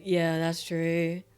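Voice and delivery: ordinary talk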